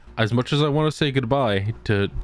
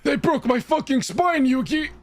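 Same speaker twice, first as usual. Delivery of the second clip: put-on much higher, whiny injured old man; hurt grandpa wail; 14 semitones higher than usual. Grandpa begging Yugi after his spine broke, high whiny wail.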